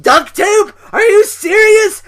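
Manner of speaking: very high, loud outburst of disbelief; incredulous shout. Acted. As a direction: shout it as if you can't believe it.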